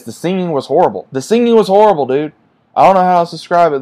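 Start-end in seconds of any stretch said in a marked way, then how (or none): 1.1-3.8 much higher and louder, fed up; exasperated outburst. Real, not acted. Reviewer venting to viewers about the songs, exasperated loud. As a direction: blurt it out in frustration. Repeated complaint, building.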